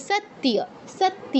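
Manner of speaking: সত্য as sat-tiya, the y heard; sounded y. Shown as a demonstration. Teacher models for students, সত্য as sat-tiya. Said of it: "তো এটা কি হবে".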